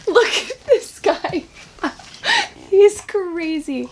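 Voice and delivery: high, laughing delight at the dog; giggling through the words. Real, not acted. Owner laughing at her wild puppy.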